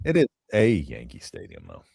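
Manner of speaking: "a" said as a stressed "ay". Real, not acted. Correcting a co-host about the stadium, stressing "a". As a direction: lean on "a" as a correction. Counters "not the Yankee Stadium.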